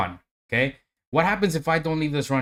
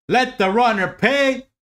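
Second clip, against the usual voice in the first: hyped up; 3 semitones higher than usual. Real, not acted. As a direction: cheer the runner along, pitch up. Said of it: "I gotta be hyped up".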